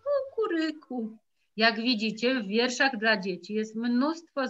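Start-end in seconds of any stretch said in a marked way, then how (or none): 0.0-1.2 a gentle mock rooster call; lightly crowed kukuryku. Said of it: "w dodatku co mówi".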